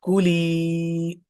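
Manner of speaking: कुली with the ली vowel drawn out long; slow kuli with held final ली; stretched long vowel. Shown as a demonstration. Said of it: "तो इसको हम खींचेंगे".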